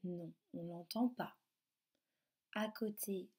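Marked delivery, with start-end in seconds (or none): none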